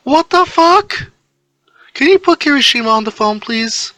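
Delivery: put-on high, loud, shocked girl's voice; shocked outburst. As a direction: shriek the curse, then rush the request. Neji shocked on phone.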